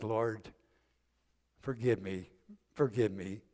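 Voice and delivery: tearful plea. Acted. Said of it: "he begins to cry".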